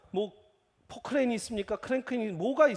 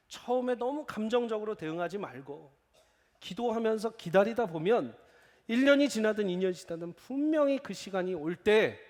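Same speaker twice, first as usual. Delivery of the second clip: ordinary talk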